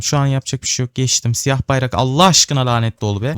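ordinary talk, nothing marked

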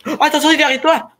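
high, fast warning yell; urgent shout. Real, not acted. Warning teammate of a zombie.

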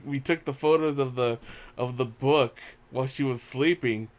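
ordinary talk, nothing marked

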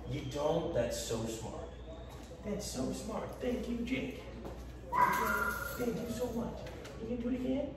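soft, high cooing praise; squeaky praising a dog. Real, not acted. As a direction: gently coo praise at the dog.

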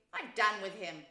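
loud, angry dismissal; savage. A narrator's reading. The rat has done with Toad, savage. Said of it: "said the rat savagely".

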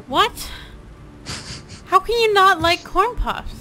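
gasp, then high, loud disbelief; slow, incredulous, scandalised protest; defensive outrage. Pretend. Corn puff fan to a non-fan, defensive outrage. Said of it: "I get really defensive of corn puffs".

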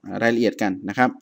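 ordinary talk, nothing marked

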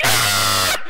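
a short, loud, panicked scream; mock terrified "Ah!" scream. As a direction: shriek like you're about to be thrown in. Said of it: "I'm like".

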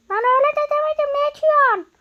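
high, loud disbelieving yelp; exasperated outburst. Real, not acted. To a friend who lay down: high loud exasperated yelp. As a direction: shout at a friend who got it wrong.

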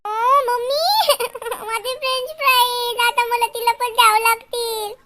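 put-on slow, drawn-out childish whine; mock crying whine. Child must share her fries, slow tearful whine. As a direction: wail softly to mummy, stretching words.

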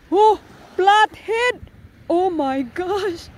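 slow, high drawn-out gasp of surprise; amazed at the catch; surprised. Real, not acted.

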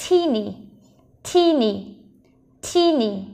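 put-on "teeny" stressed on "tee", falling on "ny"; first-syllable stress. Shown as a demonstration. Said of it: "trọng âm rơi vào âm đầu tiên".

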